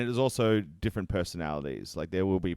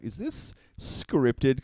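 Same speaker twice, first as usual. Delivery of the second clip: drawn-out, higher doubtful question; mock suspicion; 4 semitones higher, much slower than usual. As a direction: slowly question it as a sceptical spectator. Voicing a doubting audience member.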